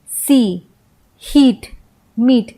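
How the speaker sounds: "सी, हीट, मीट" each with a long ee; long ee demo. Shown as a demonstration. English teacher listing long-ee example words, clearly demonstrated. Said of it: "ई का ही उच्चारण हमें मिलता है".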